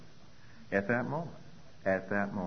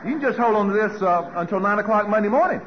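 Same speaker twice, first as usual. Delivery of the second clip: louder, higher, laughing through the words; teasing laugh; 11 semitones higher, 11 dB louder than usual. Retold or imitated. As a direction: needle him with amused mock advice. Sponsor teasing him, laughing.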